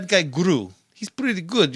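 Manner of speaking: hammed-up Slavic cartoon accent; Gru. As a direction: put on a thick Russian-style cartoon accent. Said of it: "I do an accent like that too".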